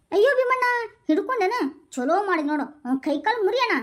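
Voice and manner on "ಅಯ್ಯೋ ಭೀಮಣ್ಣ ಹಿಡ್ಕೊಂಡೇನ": high loud cry to Bheemanna; louder, higher ayyo Bheemanna; high loud call. Acted. Calling out to Bheemanna.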